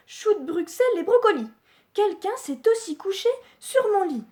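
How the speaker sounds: high, fast indignant mother bear; a quick shocked outburst; Mama Bear exclaiming. Acted. Mama Bear finding her bed slept in, high and fast.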